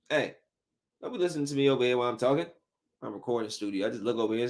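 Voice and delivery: normal talking voice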